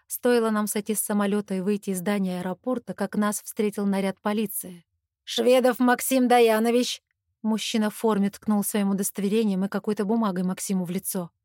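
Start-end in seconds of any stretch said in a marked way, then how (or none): 5.2-7.0 slow, loud summons of a name; loud official call. Acted. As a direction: bark the full name like a cop. Police officer calls out suspect.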